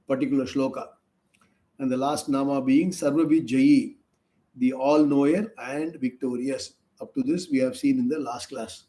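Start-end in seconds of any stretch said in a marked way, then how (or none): none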